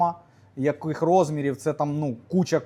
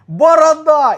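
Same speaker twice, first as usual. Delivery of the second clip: retold shout; 10 semitones higher, 10 dB louder, slower than usual. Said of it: "він кричить".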